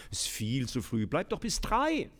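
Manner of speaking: mock generous mother. Mother answers daughter.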